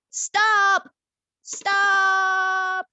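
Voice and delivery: loud high cry of stop; commanding shout. Acted. Prince shouting at the princess to stop the killing. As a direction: shout to halt the execution.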